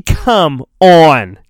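usual speaking voice

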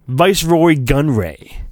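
ordinary delivery

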